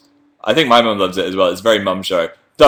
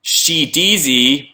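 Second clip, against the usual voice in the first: drawn-out nickname; 5 semitones higher, much slower than usual. Pretend. Giving a nickname.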